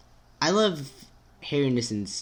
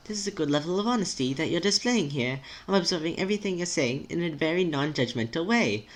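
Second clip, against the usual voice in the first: higher, careful, lilting voice; supportive, formal friend; 6 semitones higher than usual. Acted. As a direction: encourage politely in a higher pitch.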